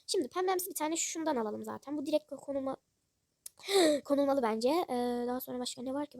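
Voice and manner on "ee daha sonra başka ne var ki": ordinary talk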